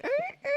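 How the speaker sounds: very high soft "Er, er" squeak; squeaky shoe noise. Retold or imitated. Imitating squeaky shoes for friends, very high squeak. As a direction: squeak like new sneakers. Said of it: "'cause it's like".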